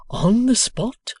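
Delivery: high, loud, slow amazed question. A narrator's reading. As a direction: Boffin amazed at Wegg's claim, high and slow. Boffin asks.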